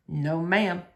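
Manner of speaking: a lightly voiced shy man; low quiet Otis. A narrator's reading. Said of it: "said Otis again".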